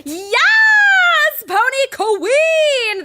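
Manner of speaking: loud, high, drawn-out whoop; elated, stretched-out shout; triumphant cheering. Acted. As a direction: cheer your friend on at full voice. Cheers friend's new confidence.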